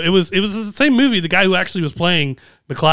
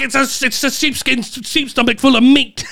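lightly mocked Scottish accent with French on top, pitched higher; a French actor faking a Scottish accent, half-joking; 6 semitones higher than usual. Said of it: "but very, like, French on top of it".

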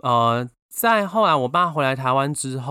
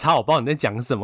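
ordinary talk

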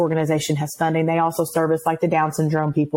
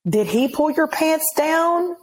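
high, slow mock-delicate question; careful questioning; 8 semitones higher, slower than usual. Officer going through all the questions, careful.

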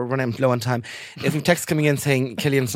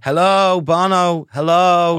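loud, higher mock hail; calling out; 4 semitones higher, 7 dB louder, much slower than usual. Podcaster pretending to call for [name], loud hail. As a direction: shout out as if hailing someone missing.

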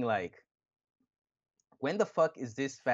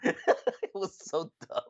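laughing; 8 semitones higher, much slower than usual. Real, not acted.

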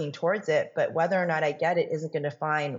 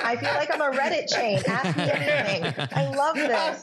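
laughing through the words, higher and bubbly; 8 semitones higher, 3 dB louder than usual. Real, not acted. Guest joking she's a Reddit AMA, laughing. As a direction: giggle as you say it.

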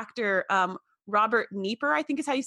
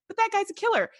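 high, startled protest; shocked disbelief; 10 semitones higher, faster than usual. Retold or imitated. Viewer recognizing the actor, high shocked exclaim. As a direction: recoil in mock alarm. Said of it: "'cause I'm like".

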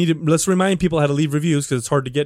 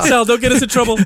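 ordinary delivery

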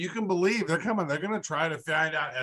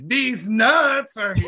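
laughing shout; 8 semitones higher, 6 dB louder, much slower than usual. Retold or imitated. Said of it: "I was gonna say".

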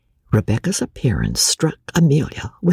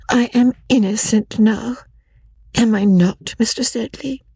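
coy Rebecca; 7 semitones higher than usual. Acted. Rebecca coaxing Joss.

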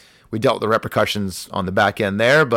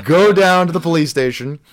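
usual speaking voice

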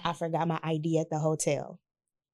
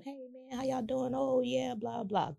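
1 quiet small talk; 6 semitones higher, 8 dB quieter than usual. Retold or imitated. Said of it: "Kevin talking to him".

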